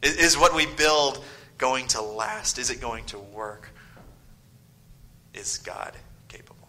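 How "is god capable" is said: hushed whisper to drive the point home; dramatic whisper. Real, not acted. Preacher to congregation, a hushed whisper. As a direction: let the line fall to a whisper.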